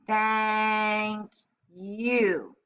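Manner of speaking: slow, drawn-out thank you; patient owner talking slowly to his parrot. A narrator's reading. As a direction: spell each word out slowly like to a pet. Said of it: "very slowly".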